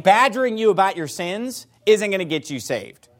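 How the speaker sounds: ordinary talk, nothing marked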